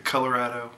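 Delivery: "Colorado" with the short a of "cat"; -rad-o ending. Shown as a demonstration. Vlogger asking viewers which is right, rad-o pronunciation. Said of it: "How do you say it?".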